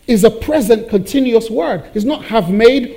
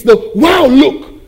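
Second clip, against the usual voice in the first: slow, louder, amazed "wow look"; awed wow look; 7 semitones higher, 6 dB louder, much slower than usual. Shown as a demonstration. Preacher showing the word's amazement, slow and awed. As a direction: draw out "wow look" with wonder. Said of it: "look at something remarkable".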